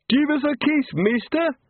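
put-on high-pitched, happy drunken heckler; put-on high voice, cheeky come-on; cheeky reveller. A narrator's reading. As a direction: pipe up high like a tipsy chancer. Carnival reveller heckles from cart edge.

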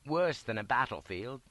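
usual speaking voice

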